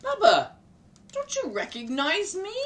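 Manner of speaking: loud, high call to Bubba; rabbi calling out. Acted. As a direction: call out to get her attention. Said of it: "said the rabbi".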